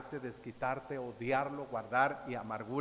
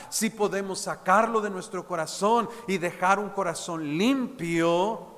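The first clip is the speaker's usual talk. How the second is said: predicación enérgica; 5 semitones higher, 10 dB louder than usual. Acted. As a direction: preach it loud and full, pulpit force. Preaching to the congregation. (predicador a la congregación, voz fuerte y enérgica)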